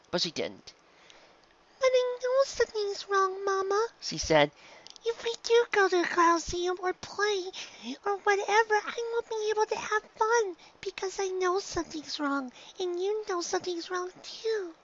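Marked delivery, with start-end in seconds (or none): 1.8-3.9 high little filly voice; a small girl pressing her mother; high-pitched child pony insisting; young filly. A narrator's reading. 5.0-14.7 high little filly voice; a small girl pressing her mother; high-pitched child pony insisting; young filly. A narrator's reading.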